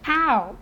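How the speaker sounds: "Ow" sounded out as the vowel in cow; phonics sound demo. Shown as a demonstration. Teacher showing the first sound of O U, "Ow" said clearly. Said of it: "I want you to hear the sounds".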